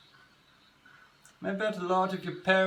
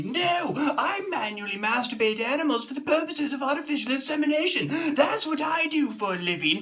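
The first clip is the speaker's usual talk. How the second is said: higher, louder, fast ranting; angry rant; 9 semitones higher, 5 dB louder than usual. Acted. Ranting at office workers about peanut butter, higher loud angry rant.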